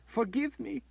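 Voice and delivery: high, tearful sobbing plea; high broken sobbing. A narrator's reading. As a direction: beg forgiveness through sobs. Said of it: "she sobbed".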